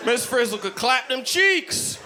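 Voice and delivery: high, hammed-up rowdy kid caricature; exaggerated slangy kid voice, drawn out.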